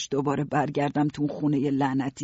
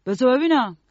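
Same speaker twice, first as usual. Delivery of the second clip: raised, louder character voice; curious man; 9 semitones higher, 7 dB louder than usual. A narrator's reading. Mr Grute asks to inspect the necklace, raised curious voice. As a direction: demand a look, pitched up and loud.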